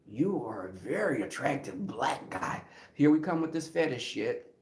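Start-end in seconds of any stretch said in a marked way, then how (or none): 0.1-2.6 smooth pickup line. Retold or imitated.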